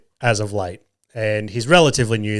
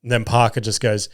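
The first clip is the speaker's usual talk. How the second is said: ordinary talk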